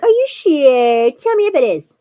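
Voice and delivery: loud, high, stretched-out pleading; mock insistence. Retelling how she questioned her partner, insistently loud. Retold anxious check with partner.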